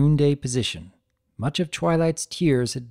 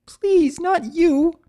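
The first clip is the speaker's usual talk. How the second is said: much higher, louder pleading; sobbing plea; 5 dB louder, much slower than usual. Acted. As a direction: beg through tears. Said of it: "She sobbed openly".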